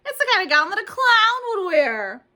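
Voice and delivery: put-on high, loud jeering boy; mean taunt. A narrator's reading. Meanie Jim mocking Junie's gown, high and loud. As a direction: sneer at her gown.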